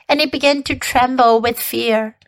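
ordinary delivery

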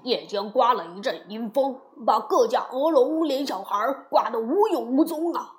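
animated officer's report; official reporting. A narrator's reading. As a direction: report it to the king with emphasis. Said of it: "只听五城兵马司奏报".

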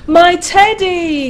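high, excited cry; cried out. A narrator's reading. Eddie reaching to catch the teddy, crying out. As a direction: call it out, pitch soaring. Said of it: "cried Eddie".

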